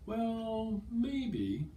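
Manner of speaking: high, drawn-out, pondering hesitation; thinking it over. Shown as a demonstration. Teacher demonstrating someone who wants to think about it, hesitant. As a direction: hold the first word high while you consider. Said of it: "whether someone wants to think about it".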